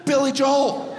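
high, emphatic "Joel" as one syllable; American pronunciation. Retold or imitated. Comic remembers who pronounces it so, "Joel" as one high syllable. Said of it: "pronounces it Billy Joel".

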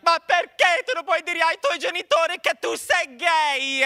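yelling in Italian. Retold or imitated. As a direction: plead angrily in Italian. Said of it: "while I'm yelling at him".

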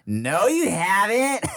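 slow, drawn-out calling out of a lie; imitating wife. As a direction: mimic the wife catching him out. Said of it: "Chia's in the background".

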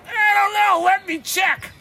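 high, loud woman shouting back from inside; shouting woman's voice. Retold or imitated. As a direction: yell it through the car door as the woman inside. Woman inside the car answering.

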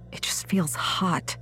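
breathy slow whisper. Acted. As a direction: let it fall to a whisper. Injured, voice dropping.

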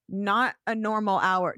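usual speaking voice

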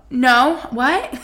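loud, baffled questioning retort; thrown off. Retold or imitated. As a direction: act out being caught completely off guard. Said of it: "I was so thrown off".

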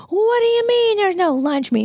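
outraged wife. Retold or imitated. Wife hearing there's no lunch meat, mock outraged high cry. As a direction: squeal it like a wife who can't believe it. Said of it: "she could have easily been like".

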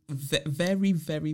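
ordinary talk, nothing marked

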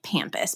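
"pampas" with the a of "air"; short-a pampas. Shown as a demonstration. Host explaining regional pronunciation, "pampas" with short a. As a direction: say "pampas" as PAM-pus. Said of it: "the Midwest or maybe the South".

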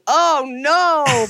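loud, high, drawn-out dismay; mock dismay. Podcaster voicing a viewer's realization, mock dismay. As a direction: wail it in playful horror.

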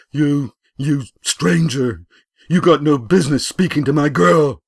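loud, high, spluttering rage; splutter, then shout him down; furious accusation. A narrator's reading. Red-faced bully warning rival off his girl, furious shout. Bully rages after being felled.

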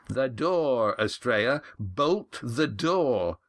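slow, insistent order; urgent reminder. Acted. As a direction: press him to bolt it. Mirac urging Estrella to lock up.

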